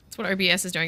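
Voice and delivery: ordinary talk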